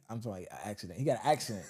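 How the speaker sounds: ordinary delivery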